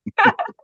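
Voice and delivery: a sudden laugh at herself; embarrassed laugh, loud and high; high burst of laughter. Real, not acted. Laughs at her own story.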